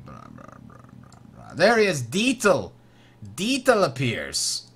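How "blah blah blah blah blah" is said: very low, hushed blahs; absent-minded mumble. Real, not acted. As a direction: skim along under your breath. Skims card text to themselves.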